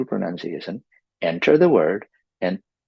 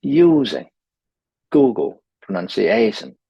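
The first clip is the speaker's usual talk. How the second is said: "Using, Google, pronunciation" with each stressed syllable punched; overdone stress; 4 dB louder than usual. Shown as a demonstration. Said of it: "So overdo it in the beginning".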